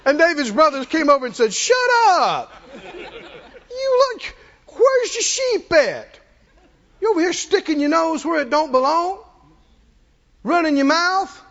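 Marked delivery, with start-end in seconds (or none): 1.5-11.4 high, indignant big-brother telling-off; mock angry scolding. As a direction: tell off the upstart, hammed-up and high. Brothers scold David in the story.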